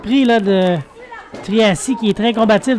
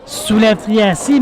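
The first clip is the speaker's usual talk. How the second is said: normal talking voice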